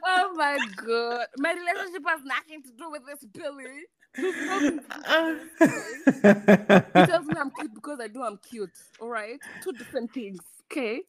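breaking up with laughter mid-defense; trying not to laugh. Real, not acted. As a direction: fight back the giggles while protesting.

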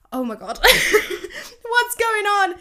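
high, loud, slow laugh-filled oh my God; laughing disbelief. Retold or imitated. To the aloof guy, loud laughing disbelief. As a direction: laugh in disbelief through the words. Confused, laughing at his coldness.

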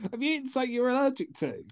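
ordinary talk, nothing marked

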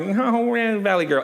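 put-on drawn-out American Valley Girl accent. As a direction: imitate a Valley Girl, slowly stretched. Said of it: "usually the American's a little bit more like".

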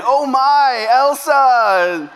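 put-on high, excited toddler squeal; imitating a little girl. Pastor imitating his friends' daughter opening her Elsa gift, high and thrilled. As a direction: squeal it like a thrilled two-year-old. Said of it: "She's saying".

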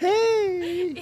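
high bright mock-cheery "Hey!"; cheery housewife hey. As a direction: chirp it like a perky housewife.

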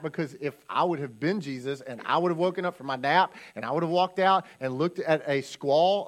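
normal talking voice

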